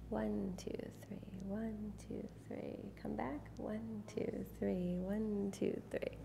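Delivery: slow, whispered one two three; hushed, slow dance count under the breath; soft counting whisper. Shown as a demonstration. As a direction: breathe the count softly to calm her. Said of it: "say it slow and soft".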